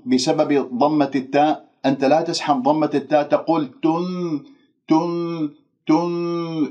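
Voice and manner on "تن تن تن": long "تن" with lips kept rounded into the n; dragged damma. Shown as a demonstration. Teacher shows lips still rounded, smeared damma on تن. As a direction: carry the "u" rounding through the ن. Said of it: "شوف كيف أنه أنا نطقت النون".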